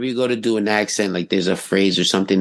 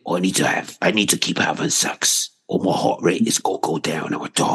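low English-accented action hero impression; Jason Statham; 5 semitones lower than usual. Film hero keeping his heart rate up, as Statham. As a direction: imitate a London tough guy, pitched down. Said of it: "What was that movie that he".

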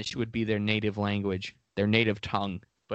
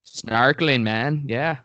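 a hint of a bad Belizean accent; lightly put-on Caribbean-style Belizean accent; attempted Belizean accent; slower than usual. Guest joking about snorkeling in Belize, attempted Belizean accent. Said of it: "what that was an attempt at accent".

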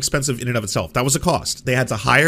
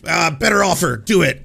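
higher, loud caricature of a demanding client; hammed-up blunt order; mock demanding shout; 5 semitones higher, 5 dB louder than usual. Imitating Steven ordering a better offer on the phone, mock demanding.

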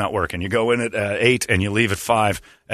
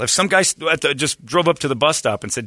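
ordinary talk

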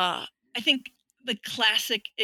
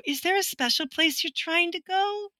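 patronizing, sing-song and higher pitched; condescending tone; 9 semitones higher than usual. Retold or imitated. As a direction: talk down to her as if to a lost child. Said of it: "they'll use this tone of".

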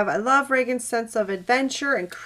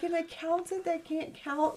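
much higher and softer, delighted; amused disbelief; 8 semitones higher, 10 dB quieter than usual. Retold or imitated. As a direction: savor the joke in a high hush. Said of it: "I was like".

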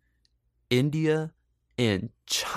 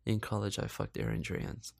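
low, softer, unvoiced confession; mock whisper; 3 semitones lower, 8 dB quieter than usual. Retelling the college confession, mock hushed whisper. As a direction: hush it like a guilty secret. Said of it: "she goes".

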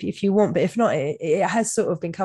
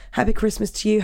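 normal talking voice

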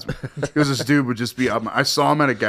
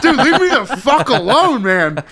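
laughing exasperation; 11 semitones higher, 6 dB louder than usual. Retold or imitated. Fed up with pestering guy.

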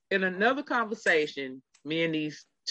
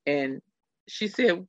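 normal talking voice

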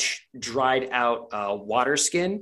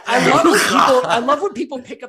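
laughing; 11 semitones higher, 9 dB louder than usual. Real, not acted. GM laughing at the natural twenty.

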